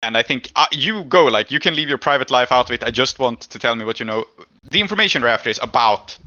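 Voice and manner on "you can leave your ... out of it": normal talking voice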